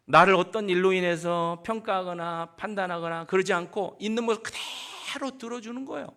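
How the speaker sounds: usual speaking voice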